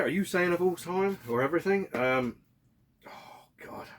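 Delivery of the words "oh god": whisper. Real, not acted. Stumped by a question.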